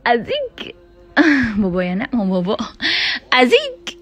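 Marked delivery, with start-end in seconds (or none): 0.1-0.7 high cooing. Real, not acted. Cooing at baby. 3.3-3.9 high cooing. Real, not acted. Cooing at baby.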